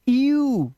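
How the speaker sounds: यू drawn out as "ee-yoo"; demonstrated sound. Shown as a demonstration. Teacher showing how EW reads, long high यू. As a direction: glide slowly from ee to oo on यू. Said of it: "इसे पढ़ते".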